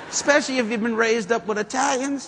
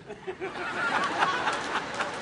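airy laugh; 9 semitones higher, 9 dB quieter than usual. Real, not acted. Preacher laughs at own point.

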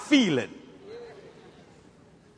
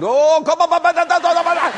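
mimicking tongues; 5 semitones higher, 4 dB louder than usual. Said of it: "She just prayed in the Holy Ghost".

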